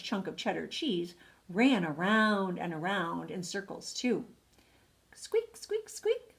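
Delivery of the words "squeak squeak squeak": tiny, very high squeaks; high-pitched mousy peeps; mouse squeaks. Acted. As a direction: squeak the word like a little mouse. Mouse's squeaks voiced in story.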